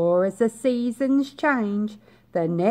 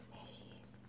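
breathy hushed whisper; 30 dB quieter than usual. A narrator's reading. Hushed aside to children.

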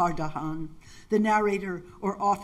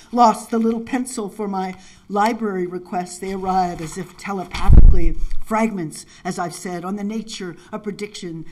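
ordinary talk, nothing marked

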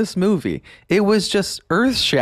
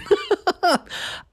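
bright laugh; high burst of laughter. Real, not acted. Laughs at the mullet.

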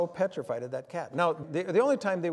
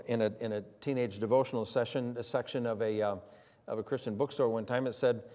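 ordinary talk

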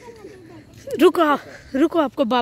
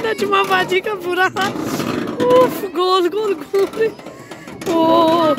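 high, laughing commentary; gasping through giggles; spinning ride laughter; 5 semitones higher, slower than usual. Real, not acted. Laughing at aunt spinning round.

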